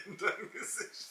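laughing through the words; giggling, high pitched. Real, not acted. Repeats the jab, laughing.

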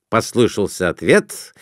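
plain reading voice

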